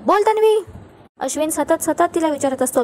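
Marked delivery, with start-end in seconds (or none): 0.0-0.6 a sharp, high shouted demand; shouting at her. A narrator's reading. Angry husband shouts at his wife to answer. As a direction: snap her name out in a pitched-up yell. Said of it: "Ashwin जोरात ओरडतो".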